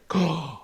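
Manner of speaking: exaggerated gasp of shock; mock shocked gasp. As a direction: suck in air as if scandalized. Mimics reaction colleagues held back.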